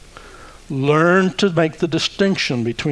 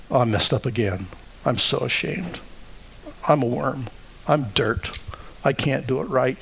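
ashamed self-talk; 2 semitones lower, 7 dB quieter than usual. Acted. A shamed believer berating himself, softly. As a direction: sigh it out like someone who feels like dirt. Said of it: "That's the voice of the accuser".

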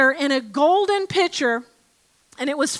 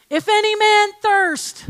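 called out higher, like a proclamation to a crowd; proclaiming; 7 semitones higher, 4 dB louder than usual. Retold or imitated. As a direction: cry it out to all the people. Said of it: "and cried, as if making proclamation".

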